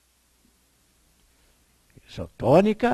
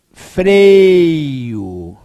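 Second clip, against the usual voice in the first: "freio" loud and long with the weight on fre; stress on fre; 8 dB louder than usual. Shown as a demonstration. Teacher to class, stressing the fre in freio. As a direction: lean hard on the fre of "freio". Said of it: "o fre é forte".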